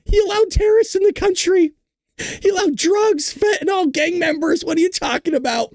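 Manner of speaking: high-pitched, laughing disbelief; can't believe what you just read; incredulous outburst. Real, not acted. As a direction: protest in a high squeal between laughs. Incredulous outburst amid laughing fit.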